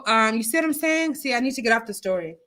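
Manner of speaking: usual speaking voice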